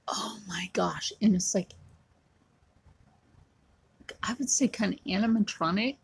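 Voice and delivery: hushed, thrilled whispering; excited whisper. Real, not acted. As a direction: breathe it out in awe. Excited about a light display.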